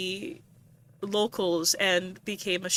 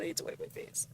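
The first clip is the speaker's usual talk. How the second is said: whispering after crying; tearful whisper; 7 semitones higher, 16 dB quieter, much faster than usual. Real, not acted. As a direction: breathe it out through tears. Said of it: "I'm crying".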